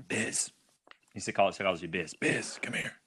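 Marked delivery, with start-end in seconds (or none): 0.1-0.5 low, quiet gravelly man's call; lightly voiced gravelly stranger. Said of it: "he has a kind of a gravelly voice". 1.9-2.9 quiet low gravelly beckoning; hint of a gravelly stranger calling. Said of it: "he has a kind of a gravelly voice".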